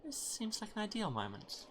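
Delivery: eager to perform; lightly voiced minstrel; a hint of a bard voice. Voicing the bard.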